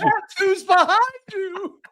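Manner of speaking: high, slow put-on voice; mock high voice. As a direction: squeak it out teasingly. Echoing in a mock voice.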